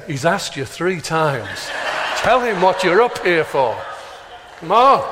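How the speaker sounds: God pushing him to speak up, building; voice of God urging. Retold or imitated. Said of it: "It's like God is saying".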